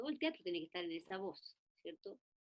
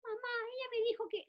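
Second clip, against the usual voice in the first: put-on very high whiny child voice; tattling child; 4 dB louder than usual. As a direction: tell on your sister to mom. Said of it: "Los niños juegan". (niña acusando a su hermana con voz muy aguda)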